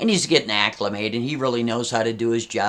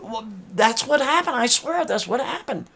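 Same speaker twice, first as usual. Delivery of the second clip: higher, squeaky defensive pleading; squeaky higher mock swearing; whiny pleading; 11 semitones higher than usual. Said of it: "Garrett's like".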